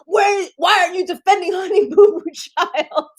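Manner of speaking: high, shouted outrage; retold rant. Berating the actress for not protecting the kid, high shout. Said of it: "I was like".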